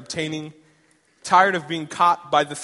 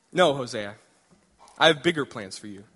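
voice of God; 5 semitones lower than usual. Acted. God answering eager Hosea, low and calm. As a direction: speak as God, low and steady.

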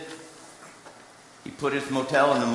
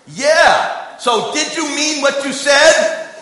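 eager, much higher and louder burst; higher, louder, slower eager question; excited phone voice; 12 semitones higher, 10 dB louder, much slower than usual. Retold or imitated. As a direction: jump on it like a thrilled kid on the phone. Caller excitedly asks about promise.

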